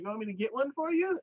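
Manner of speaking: high, quick mock offer; imitated eager suitor. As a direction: offer a gift as a keen date.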